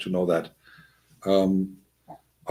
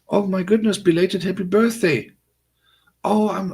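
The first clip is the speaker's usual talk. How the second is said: dismayed apology; 8 semitones higher than usual. Real, not acted. Streamer realises he missed Lily's birthday, high dismayed apology. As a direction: wail apologetically, pitched well above usual.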